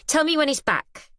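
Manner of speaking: impatient dismissal of the wife; huffy. Acted. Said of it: "she huffs".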